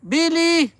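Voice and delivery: high, loud pet call. Real, not acted. Owner summons the cat Billy, calling out. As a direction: call the cat from across the yard.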